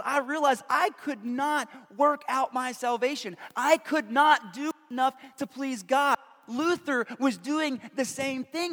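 high, emphatic sermon delivery; impassioned preaching. Real, not acted. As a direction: drive each phrase home to the congregation. Preaches at rising force.

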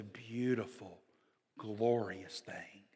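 slow, hushed whisper; reverent whisper. Acted. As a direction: breathe it out in awe. Awe at God's work.

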